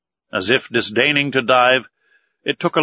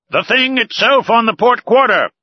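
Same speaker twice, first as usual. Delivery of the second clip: high, loud cry from the masthead; shouting from crow's nest; 11 semitones higher, 5 dB louder than usual. A narrator's reading. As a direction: call it out to the whole ship. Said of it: "cried the harpooner".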